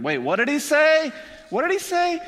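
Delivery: high-pitched, incredulous question; startled disbelief. Pretend. Friends on the roof reacting to forgiveness, startled.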